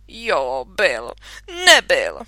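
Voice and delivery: low, loud mock argument; teasing back-and-forth. Minka bickering with father, stubborn. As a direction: toss the words back stubbornly.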